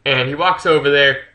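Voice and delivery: usual speaking voice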